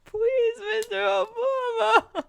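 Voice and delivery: very high, slow, tearful plea; mock begging. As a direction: beg in a hammed-up squeaky whine. Hammed-up begging after the yelling.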